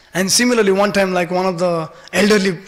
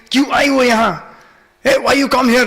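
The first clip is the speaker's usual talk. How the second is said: rude questioning, raised pitch and louder; Krishna; 6 semitones higher, 4 dB louder than usual. Retold or imitated. Little Krishna greeting the elderly gopi, so rudely. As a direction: challenge them bluntly, high and forceful. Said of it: "like, so rudely".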